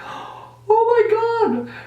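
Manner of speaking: very high, slow drawn-out shocked wail; horrified. Retold or imitated. Seeing her brother's bloody face, high slow horrified wail. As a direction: stretch it out, voice flying up in horror.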